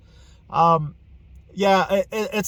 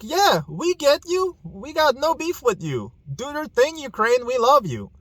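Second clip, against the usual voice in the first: imitating friendly Russians, higher and easygoing; lightly played friendly Russian folks; 8 semitones higher than usual. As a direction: voice ordinary Russians, high and chummy. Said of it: "They're like".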